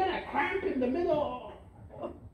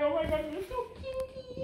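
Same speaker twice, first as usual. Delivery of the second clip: higher, softer gush; mock gushing lover; 9 semitones higher, 4 dB quieter than usual. Young lover to partner, higher cooing. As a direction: coo it like a smitten partner.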